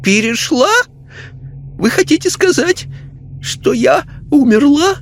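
high, shaken lady's voice; disbelieving lady. A narrator's reading. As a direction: grasp at the word in disbelief. Lady spirit asks.

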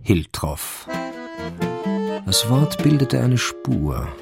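ordinary talk, nothing marked

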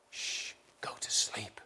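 put-on soft, slow hush to a restless child; soothing whisper. Shown as a demonstration. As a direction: whisper as to a grandson at night. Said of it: "address to my children or my grandchildren".

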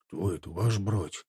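low, hushed aside in the ranks; quiet question. A narrator's reading. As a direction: ask softly without being overheard. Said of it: "ответил также тихо Тимофей".

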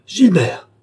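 loud, high exclamation of shock; startled cry. A narrator's reading. Young woman recognizing the man in the dark, crying out. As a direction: cry out the name in alarm. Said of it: "s'écria-t-elle".

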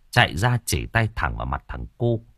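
plain reading voice

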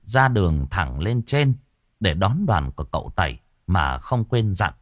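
ordinary delivery, nothing marked

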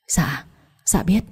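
put-on a beaten child whispering her answer slowly; slow, breathless, frightened whisper; terrified whisper. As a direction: whisper it out in fear, barely daring to speak.

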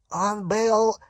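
high, slow, strained croak; choked werewolf rasp; hoarse wheeze. A narrator's reading. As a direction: force the words out with effort. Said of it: "прохрипел Фенрир".

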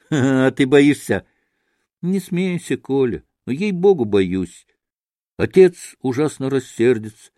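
ordinary delivery

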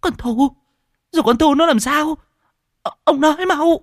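cao vút, hoảng hốt dồn dập; gặng hỏi trong hoảng loạn. Acted. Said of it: "nước mắt cũng tuôn trào". (giọng cao hốt hoảng)

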